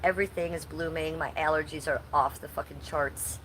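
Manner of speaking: ordinary talk, nothing marked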